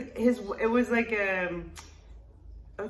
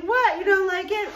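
high, loud mock surprise; surprised girlfriend; 10 semitones higher, 6 dB louder than usual. Boyfriend hears she dislikes it, high mock surprise. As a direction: squeak it out in disbelief.

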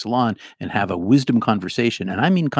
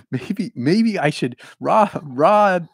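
Boston accent; 9 semitones higher, 8 dB louder, much slower than usual. Shown as a demonstration. Maybe I should bring back my Boston accent.

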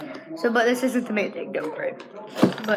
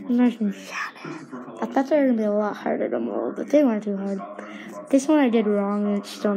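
slurred through dental grills; speaking with grills on teeth. Real, not acted. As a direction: metal covering teeth. Seen 1: Gold grills clearly visible on teeth throughout while speaking.

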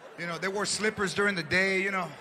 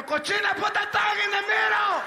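high shouted scolding; grandma shouting at the aunt; grandmother yelling; 9 semitones higher than usual. Retold or imitated. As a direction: yell at her like an outraged grandma. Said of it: "my grandmother would yell".

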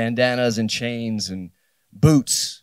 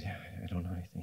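fast, much softer mumble; meek mumbled admission; 4 semitones lower, 17 dB quieter, much faster than usual. Retold or imitated. Caught freshman admits defeat to punk, fast soft mumble. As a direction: rush it out under your breath.

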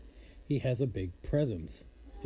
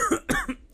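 sudden high choking cough; 5 dB louder than usual. Real, not acted. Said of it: "I'm choking".

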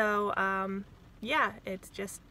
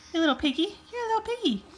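higher sing-song cooing; baby talk; 9 semitones higher, faster than usual. Acted. Mom cooing at her hungry baby in baby talk. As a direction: coo at the baby.

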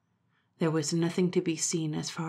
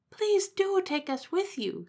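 much higher, slow pleading bird voice; pleading pheasant; slower than usual. Acted. Pheasant begging Momotaro, high and slow. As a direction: beg in a high small voice.

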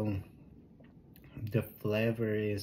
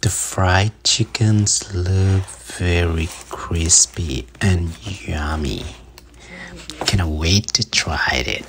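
loud, bright presenter voice; upbeat vlog intro; 2 semitones lower, 17 dB louder than usual. Acted.

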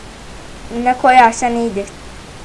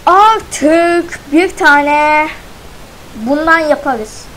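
slow drawn out; 4 semitones higher, 4 dB louder, much slower than usual. Real, not acted. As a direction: stretch out the words slowly and louder. Drawn-out announcement.

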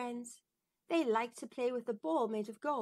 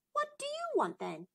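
princess voice. Acted. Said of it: "said the princess".

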